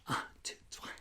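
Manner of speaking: soft secret count; whispered count. Shown as a demonstration. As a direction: whisper it, barely a breath. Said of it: "whispered count".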